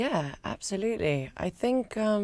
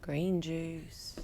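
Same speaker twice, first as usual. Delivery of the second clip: whisper; 11 dB quieter than usual. Real, not acted. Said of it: "say what you had for breakfast".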